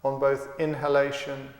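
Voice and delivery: calm, unhurried meditation guide with long pauses; guided meditation. Acted. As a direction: count and guide the breath steadily, leaving space between phrases. Leads a guided breathing meditation.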